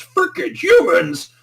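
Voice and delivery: high, loud, drawn-out mock yell; mock angry outburst. Retelling the villain ranting about humans, hammed-up fury. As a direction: burst out in hammed-up outrage. Acting out raging Suzaku, interrupting.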